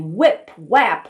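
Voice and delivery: ordinary delivery, nothing marked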